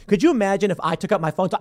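ordinary delivery

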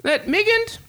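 high, formal, betrayed king; a grave king, pitched well above his own voice. Acted.

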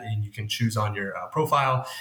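normal talking voice

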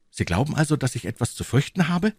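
high-pitched incredulous question; young man doubting a warning; young D'Artagnan, questioning. Acted. D'Artagnan asking Tréville about danger, high questioning voice. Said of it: "fragte D'Artagnan".

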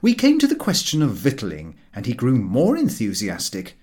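ordinary delivery, nothing marked

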